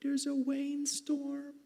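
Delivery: high, slow little-girl voice imitating his daughter; squeaky toddler calling Daddy about the storm; little daughter's high voice reporting a thunderstorm. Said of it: "my little daughter Emily ... she goes".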